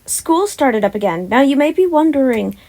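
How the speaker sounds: usual speaking voice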